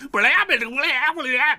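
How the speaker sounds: high, loud goblin babble; mock a goblin yammering nonsense; goblin gibberish. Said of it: "he's talking in Goblin".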